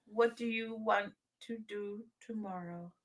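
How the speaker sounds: normal talking voice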